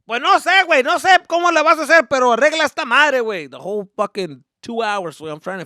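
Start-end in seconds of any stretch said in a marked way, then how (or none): 0.1-3.5 high, loud demanding order in Spanish; stern dad ordering. Retold or imitated. Dad telling son to fix the computer, loud stern order. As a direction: tell the kid to fix it or else.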